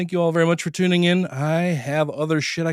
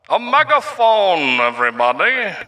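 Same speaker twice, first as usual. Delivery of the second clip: loud, slow megaphone call; 4 semitones higher, 3 dB louder than usual. Shown as a demonstration. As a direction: announce it through a megaphone. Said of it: "speaking on a megaphone".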